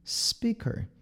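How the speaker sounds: SPEAKER starting on a quiet, gentle S; soft S. Shown as a demonstration. Teacher models for learners, soft starting S. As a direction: say "speaker" softly, no vowel before S.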